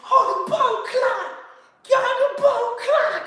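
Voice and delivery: high, drawn-out Creole wail; mock Haitian Creole. Roommate carrying on in the hallway, high mock Creole cries. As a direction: cry out slowly in a hammed-up Haitian Creole accent. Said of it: "start speaking in Creole".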